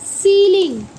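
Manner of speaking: emphatic SEE-ling for सीलिंग; demonstrating pronunciation. Shown as a demonstration. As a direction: model सीलिंग firmly for the class. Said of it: "क्या बोलते हैं बच्चों".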